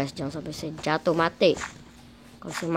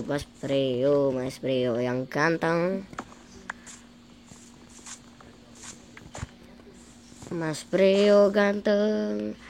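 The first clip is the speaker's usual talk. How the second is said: drawn-out coaxing; much slower than usual. Acted. Coaxing friend in game.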